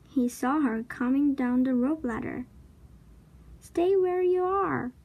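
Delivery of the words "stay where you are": high, sustained storybook call; shout. A narrator's reading. Jack warns Annie to stay back, mild high-pitched shout. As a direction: call out a warning in a raised pitch. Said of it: "Jack shouted".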